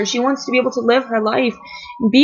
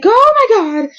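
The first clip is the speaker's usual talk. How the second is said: high, louder mock shriek of shock; retold scream; 10 semitones higher, 4 dB louder than usual. Telling viewers about the twist, shrieking in shock. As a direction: replay the startled scream. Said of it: "I, I screamed".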